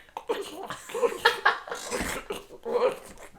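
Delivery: high airy wheeze like a struggling pug; wheezing pug noise. Retold or imitated. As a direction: mimic the dog's raspy breathing. Imitating the pug's wheezing noise.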